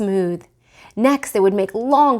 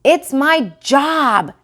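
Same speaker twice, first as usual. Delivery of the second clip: put-on slow, high little-boy insistence; small boy insisting; 6 semitones higher, 6 dB louder, much slower than usual. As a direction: stretch it out stubbornly like a small child. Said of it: "said Petey".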